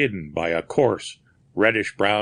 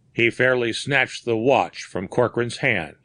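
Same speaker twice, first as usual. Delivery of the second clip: ordinary delivery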